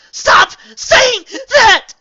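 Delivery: very high, loud, slow scream; furious scream. A narrator's reading. Astor screams while attacking Celestia. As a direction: scream each word as she attacks.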